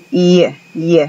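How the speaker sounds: Y said almost like a Spanish I, but not quite; English Y sound. Shown as a demonstration. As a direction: glide into Y close to an I. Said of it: "es casi una I, pero no exactamente".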